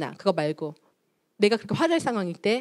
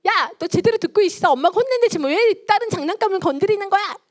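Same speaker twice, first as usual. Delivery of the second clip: shrieking, much higher and louder scolding; angry mother; 11 semitones higher, 6 dB louder than usual. Acted. Mother scolding her child for drawing on the wall, loud and high. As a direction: explode at the child over the scribbled wall. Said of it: "감정을 다 쏟아버렸고요".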